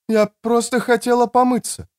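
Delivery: high-pitched woman's voice; woman explaining herself. Acted. Woman caught washing explains to the man, high-pitched. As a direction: put on a high female voice.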